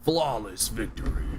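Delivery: slow deep game announcer. Retold or imitated. Imitating the game announcer on stream. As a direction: boom it out like a fighting game. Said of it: "when you try to do a deep voice".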